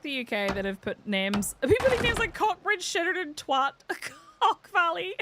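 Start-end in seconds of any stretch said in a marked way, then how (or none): 1.6-5.2 high voice breaking into laughter; giggling. Real, not acted. Streamer lists rude UK place names, giggling. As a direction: struggle through the names while giggling.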